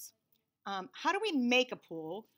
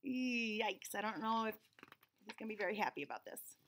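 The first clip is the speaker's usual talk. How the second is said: nervous aside; 8 dB quieter than usual. A narrator's reading. Aside fearing father's reaction.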